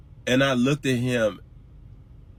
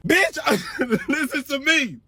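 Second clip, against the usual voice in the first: laughing; 12 semitones higher, 3 dB louder than usual. Real, not acted.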